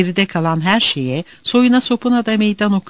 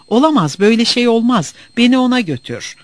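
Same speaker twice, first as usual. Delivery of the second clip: raised pitch, emphatic protest; outraged cry; 6 semitones higher than usual. A narrator's reading. Orhan Bey demanding to be taken to his student, outraged cry. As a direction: insist urgently, crying out in moderate force. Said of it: "diye haykırmıştı".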